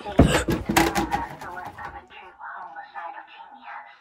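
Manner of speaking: long whispered gasp of fright; startled gasp. Real, not acted. Player spooked by the doll, shocked gasp. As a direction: suck in air in shock.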